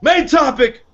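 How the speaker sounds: mimicked sudden outburst; mock announcement. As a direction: blurt it out as an abrupt announcement. Mimics abrupt jump of topic.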